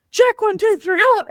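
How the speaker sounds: high-pitched drill count, mock yell; imitated yelling. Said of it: "he's yelling and yelling".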